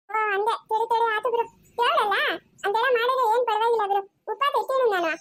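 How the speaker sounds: put-on high child voice giving in; agreeable little child. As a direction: agree readily in a kid's voice. Child gives in about fasting. (ಒಪ್ಪಿಕೊಳ್ಳುವ ಪುಟ್ಟ ಮಗುವಿನ ಧ್ವನಿ)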